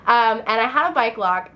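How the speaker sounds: ordinary talk, nothing marked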